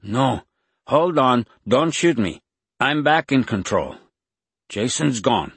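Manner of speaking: high, loud put-on Mexican accent; imitated Mexican accent. A narrator's reading. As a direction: protest urgently in a borrowed Mexican accent. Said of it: "He heard the man's Mexican accent".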